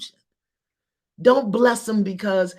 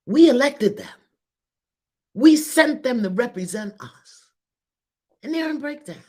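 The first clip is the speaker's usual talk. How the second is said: impassioned; 7 semitones higher, 5 dB louder than usual. Real, not acted. Impassioned point about leaders.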